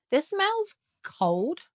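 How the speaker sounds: high, slow and amazed; drawn-out wonder. Real, not acted. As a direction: stretch it out in awe. First sniff of a fragrance.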